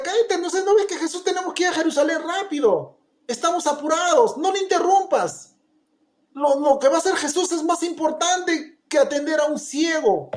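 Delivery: high, fast, irritated hushing; impatient crowd. Retold or imitated. Crowd hurrying to Jerusalem scolding the beggar, impatient. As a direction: brush him off, we're in a hurry. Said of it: "la gente le decía".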